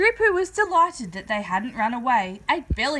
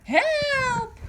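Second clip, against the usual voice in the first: very high panicked squeal; cry for help; 13 semitones higher, 3 dB louder than usual. A narrator's reading. The other sister terrified of the water, high squeal. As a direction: shriek it out. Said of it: "the other one cried".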